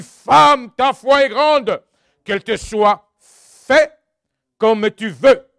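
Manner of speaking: loud, slowing proclamation of Jesus's words; preaching at full force. Acted. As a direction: declare it like a blessing from the pulpit. (proclamation forte et ralentie, en prêchant)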